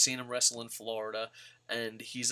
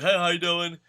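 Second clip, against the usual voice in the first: chirpy, louder, higher mock chauffeur voice; mock Matt Hardy; 4 semitones higher, 5 dB louder than usual. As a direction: play the cheerful designated driver, higher and louder. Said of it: "He's like".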